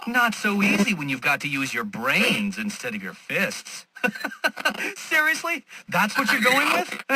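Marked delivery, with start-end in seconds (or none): 3.9-4.9 high scornful laugh; derisive laugh. Acted. Villain laughing at Batman's feeble move, derisive laugh. 5.0-7.0 high incredulous scoff; scornful disbelief. Acted. Villain scoffing at Batman's chosen approach, scornful disbelief.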